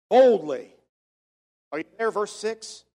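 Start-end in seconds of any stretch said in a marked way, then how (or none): 0.1-0.7 loud, emphatic burst; bold. Shown as a demonstration. Preacher giving his congregation the answer from the verse, boldly. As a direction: say it boldly and firmly. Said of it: "not sheepishly, but boldly say".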